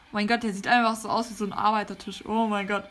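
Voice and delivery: usual speaking voice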